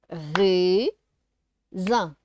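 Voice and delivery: "Raisin" split into a long rising "rai", pause, "sin"; syllable by syllable. Shown as a demonstration. Teacher sounding out syllables for a child, "Raisin" split in two. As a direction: break "Raisin" into two stretched beats. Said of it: "de faire les syllabes".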